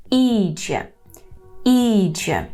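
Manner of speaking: Іджє with a long, stressed first і. Shown as a demonstration. Teacher models Polish for learners, stress on і in Іджє. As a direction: lean on і in Іджє, let джє fall. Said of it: "Наголошуємо і".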